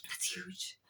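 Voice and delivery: breathless whisper, almost no voice. Real, not acted. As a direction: drop to a whisper as if it's too big to say aloud. Awed aside after breaking cycle.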